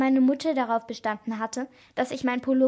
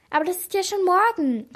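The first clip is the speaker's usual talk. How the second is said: higher, louder realization; startled exclamation; 7 semitones higher, 6 dB louder than usual. A narrator's reading. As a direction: cry out in sudden alarm at the date. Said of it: "rief Sarah". (erschrocken ausrufen)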